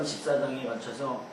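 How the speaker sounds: usual speaking voice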